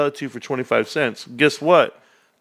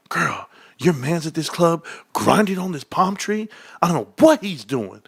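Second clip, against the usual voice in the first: higher, swooping imitated gossip; wife's girlfriends; 5 semitones higher than usual. Wife's friends reporting her man at the club, higher imitated gossip. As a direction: tattle in a put-on girlfriend voice. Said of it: "they were like".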